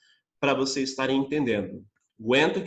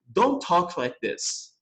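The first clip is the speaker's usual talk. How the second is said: slow contracted form; much slower than usual. Shown as a demonstration. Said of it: "é mais comum ouvir um americano falar".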